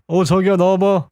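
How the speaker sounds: gruff, demanding call at the door, half joking; like a debt collector. Retold or imitated. Man calling his partner out of the room like a debt collector. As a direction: call her out like you came to collect money. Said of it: "돈 받으러 온 사람 같아".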